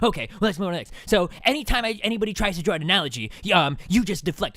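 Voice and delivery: mocking the opponent. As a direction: ham up his smug accusation. Mocking the Naked Ape guy.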